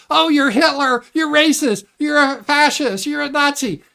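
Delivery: high, loud name-calling parody; mock accuser. Streamer parodying accusers, high and loud. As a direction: shriek the accusations like a caricature. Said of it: "someone says".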